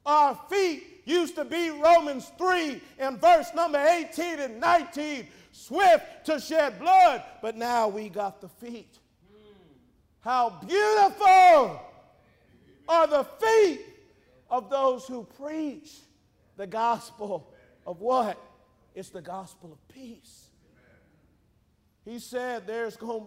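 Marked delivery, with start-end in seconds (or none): 0.1-9.0 high, loud sermon cadence; forceful preaching. Acted. Preacher cites Romans on feet, forceful preaching. 10.2-18.4 slow, loud, high proclamation easing off; exultant preaching cry. Real, not acted. Preacher exclaims scripture about those who preach, proclaiming.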